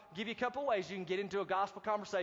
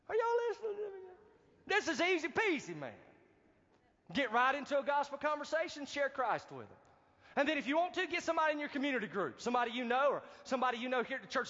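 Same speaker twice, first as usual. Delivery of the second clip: preaching at full force; 6 semitones higher than usual. Acted. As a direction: rise up and exhort the church.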